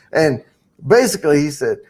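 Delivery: ordinary talk, nothing marked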